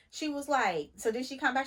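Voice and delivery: ordinary delivery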